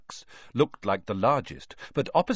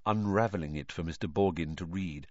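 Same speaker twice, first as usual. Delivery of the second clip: plain reading voice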